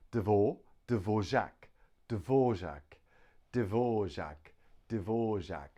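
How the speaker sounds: Dvorak said slowly as Dvo-ro-zhak, a clear v after the d; teacher sounds out Dvorak syllable by syllable; three-syllable Dvorak. Shown as a demonstration. Pronunciation teacher drilling the class on Dvorak syllable by syllable. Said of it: "Three syllables".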